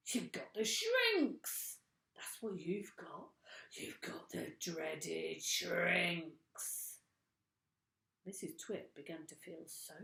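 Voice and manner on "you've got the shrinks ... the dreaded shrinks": slow, breathy ghost-story whisper; spooky whisper. A narrator's reading. Mr Twit spooking his wife in a slow whisper. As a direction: whisper the doom to scare her.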